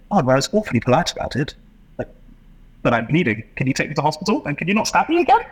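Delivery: a mannerly Brit bleeding but still polite, getting shriller; politely outraged victim. Retold or imitated. Stabbed Englishman begging politely for hospital, rising prim outrage.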